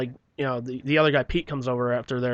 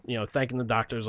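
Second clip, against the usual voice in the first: usual speaking voice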